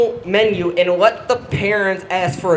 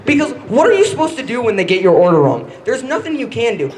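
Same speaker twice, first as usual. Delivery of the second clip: usual speaking voice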